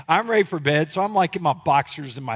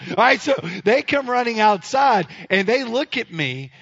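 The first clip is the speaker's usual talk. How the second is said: normal talking voice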